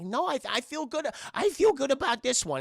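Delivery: high-pitched, insistent character voice; lightly cartoonish, hopeful little guy; upbeat Whitey voice. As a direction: squeakily protest your optimism. Whitey insists he will win.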